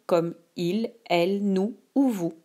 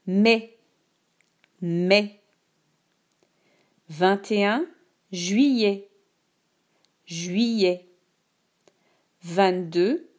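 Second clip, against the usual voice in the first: slow, clear dictation voice; dictating; slower than usual. Shown as a demonstration. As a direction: read each word slowly for dictation. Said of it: "Écoute attentivement la dictée".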